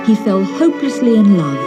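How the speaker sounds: usual speaking voice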